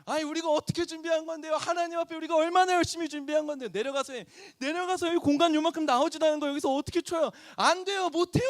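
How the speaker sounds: high, mock angry protest; retold yelling fight. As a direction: replay the shouting match with the elder, pitched high. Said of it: "고래고래 싸우면서 소리 지르면서". (장로님과 싸우던 장면을 높은 목소리로 재현하는 흉내 낸 고함)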